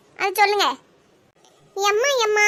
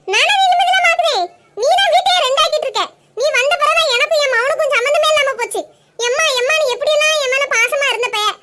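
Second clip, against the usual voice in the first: angry shouting; 4 semitones higher than usual. Acted. Mother-in-law shouts at her daughter-in-law, blaming her for the rift. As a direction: let her have it at full volume.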